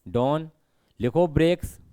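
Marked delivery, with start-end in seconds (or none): none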